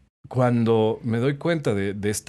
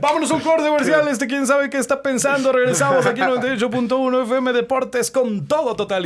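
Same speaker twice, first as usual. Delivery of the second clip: much higher and louder, booming radio-station patter; radio announcer; 8 dB louder than usual. Acted. Rookie tosses to a commercial break in a much louder and higher radio announcer voice. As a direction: sell the station ID like a hyped DJ.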